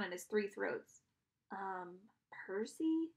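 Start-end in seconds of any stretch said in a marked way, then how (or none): 1.5-3.1 tentative, uneasy query; nervous interjection. A narrator's reading. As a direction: timidly try to get a friend's attention. Nervous friend interrupts hero.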